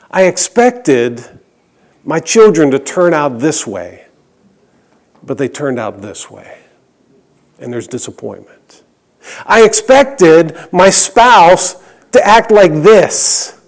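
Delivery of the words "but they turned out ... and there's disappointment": much lower and much softer, sinking; dropping to a hush. Acted. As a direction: let the refrain fall away to almost nothing. Sermon refrain, dropping away.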